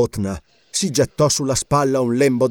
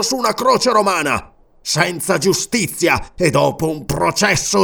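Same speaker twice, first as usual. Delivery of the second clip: vengeful oath; 8 semitones higher, 4 dB louder than usual. A narrator's reading. King swearing vengeance on Roman butchers, raging. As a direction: swear bloody revenge.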